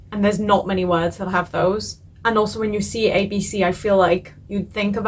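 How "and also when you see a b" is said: ordinary talk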